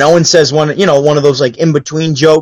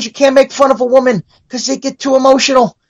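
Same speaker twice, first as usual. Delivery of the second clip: high-pitched retold punchline; imitating stage comic; 10 semitones higher than usual. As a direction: pitch it up like a comic addressing the crowd. Comic's punchline about ejected heckler.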